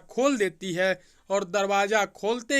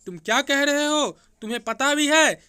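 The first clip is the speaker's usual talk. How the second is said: higher, louder disbelief; shocked; 6 semitones higher, 5 dB louder than usual. A narrator's reading. Shivani, hearing the truth, asks again in shock. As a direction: ask in stunned disbelief. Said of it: "Shivani हैरान होकर बोली".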